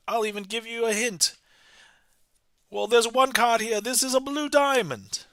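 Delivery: put-on high, sustained old-time announcer pitch; hammed-up vintage radio patter, pitched well above normal talk; 1920s radio announcer. Magician answers imagined accusation of cheating, old-time radio announcer voice. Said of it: "am I like a radio announcer".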